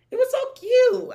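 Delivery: high, loud little squeal; excited gushing. Real, not acted. Friend gushing about her laminated card, high and excited.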